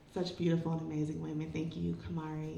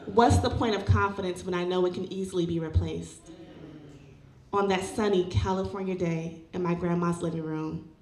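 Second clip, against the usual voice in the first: measured poetry recital, louder than casual chat; spoken-word poem; 6 dB louder than usual. Acted. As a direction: recite with deliberate phrasing and pauses. Performs a personal poem onstage.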